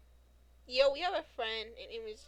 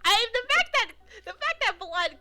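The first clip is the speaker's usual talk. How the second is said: sudden high, loud burst of glee; excited outburst; 10 semitones higher, 12 dB louder than usual. Real, not acted. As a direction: blurt it out thrilled, almost laughing. Excited reaction to friend's call.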